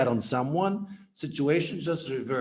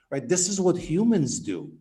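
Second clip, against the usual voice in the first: ordinary talk, nothing marked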